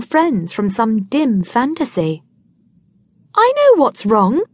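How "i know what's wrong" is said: sudden. A narrator's reading. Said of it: "she says suddenly".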